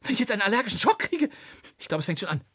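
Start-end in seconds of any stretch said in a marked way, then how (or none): 0.0-1.3 higher, louder, rushed fear of shock; a stung father fearing an allergic reaction; panicky. Acted. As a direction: gabble it in a growing panic.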